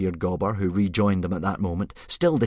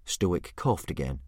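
usual speaking voice

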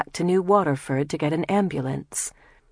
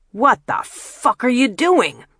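high, loud, outraged yell; furious shout; 8 semitones higher, 6 dB louder than usual. Acted. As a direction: scream it as the car swerves. Jamil yells during swerve.

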